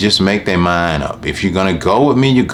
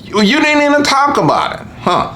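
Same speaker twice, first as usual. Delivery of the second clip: very high, incredulous protest. Retold or imitated. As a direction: squeak out your disbelief. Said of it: "You're like".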